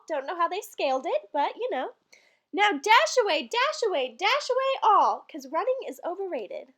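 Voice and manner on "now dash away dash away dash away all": calling reindeer names. Acted. Santa calling his reindeer in the poem, called out. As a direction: call each reindeer like a driver. Poem's driver commands.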